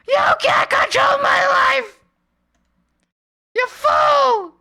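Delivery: high, loud, slow shrieked defiance; hammed-up furious shriek; mock screamed rage. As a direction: scream it at the boss in fake fury.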